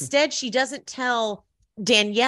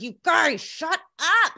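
higher, mildly mocking yell of "You guys, shut up"; 9 semitones higher, slower than usual. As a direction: imitate the host screaming at the cast, half-hearted. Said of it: "he screams at all of them".

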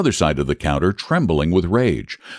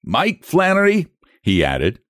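higher, stubborn Irish clerk; Irish brogue; 6 semitones higher than usual. Acted. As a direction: lecture him in a thick brogue. Flannery insists in brogue.

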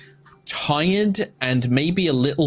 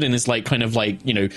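normal talking voice

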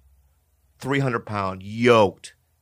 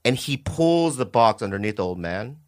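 ordinary talk

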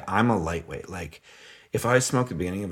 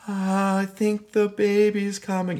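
higher, slow call from the bathroom; wife calling from afar; 11 semitones higher, slower than usual. Retold or imitated. Wife in the bathtub calling to husband, higher and slow. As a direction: call out like she's down the hall. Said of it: "she's like".

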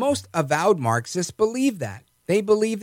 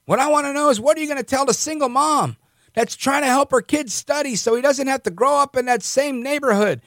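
higher-pitched, fervent mock rant; mock yelling Biden; 6 semitones higher than usual. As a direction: play an old politician railing at a reporter. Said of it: "he starts yelling at the reporter".

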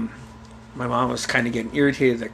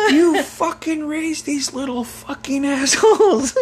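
high, drawn-out furious mother; imitated tearing-into tirade. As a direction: tear into your husband, voice way up. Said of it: "she just tore into my dad".

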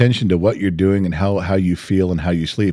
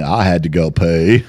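mock Forrest Gump; 5 semitones lower than usual. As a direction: imitate her as Forrest Gump. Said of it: "almost a Forrest Gump moment".

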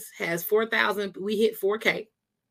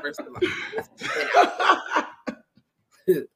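higher, laughing through the words; 11 semitones higher than usual. Real, not acted. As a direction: crack up mid-sentence. Host laughing at guest's joke.

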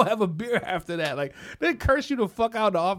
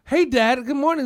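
high, loud, chirpy imitation; hammed-up sunny hello; mock cheery greeting; 6 semitones higher, 7 dB louder than usual. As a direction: play an eager child greeting Dad. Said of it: "somebody be like".

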